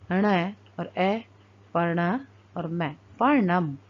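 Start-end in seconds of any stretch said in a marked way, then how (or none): none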